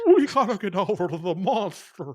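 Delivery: hammed-up army talk; mock army guys. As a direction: play a gung-ho soldier. Imitating army soldiers' talk.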